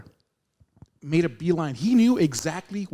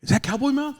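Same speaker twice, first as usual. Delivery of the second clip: excited; 10 semitones higher than usual. Retold or imitated. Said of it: "he goes".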